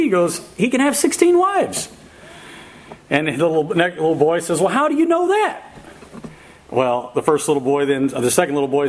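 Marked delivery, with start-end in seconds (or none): 0.6-1.9 high-pitched voicing of a kid making a big claim; amazed little boy. One little boy answers his friend at a wedding, amazed. Boy's astonished answer in joke. 4.6-5.6 put-on high-pitched kid's question, rising at the end; puzzled little boy. Little boy questions his friend's claim, puzzled and high-pitched.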